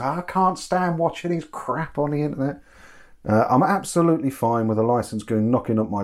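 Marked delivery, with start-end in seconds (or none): 0.0-2.6 imitating mum. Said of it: "Me mum would say".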